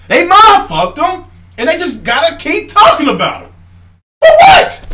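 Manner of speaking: loud, high, exasperated shouting; angry yelling. Real, not acted. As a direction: shout it at them, fed up. Yelling at fanboys.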